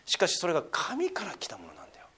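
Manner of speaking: soft confiding whisper. Real, not acted. Pastor confiding to the congregation. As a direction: lean in and whisper it. Intimate point about God's gifts.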